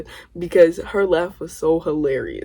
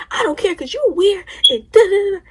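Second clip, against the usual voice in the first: high mock yell, much higher; mock screaming; 11 semitones higher than usual. Narrator screaming at cousin on the kitchen floor, high mock yell. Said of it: "we screaming at the top of our lungs".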